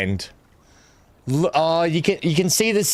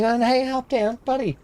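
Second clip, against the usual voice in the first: dog talk; 9 semitones higher than usual. Real, not acted. Talking to the dog.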